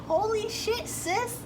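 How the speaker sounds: playful high-pitched alarm; shocked. Retold or imitated. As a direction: imitate a stunned test taker. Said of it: "And you're like".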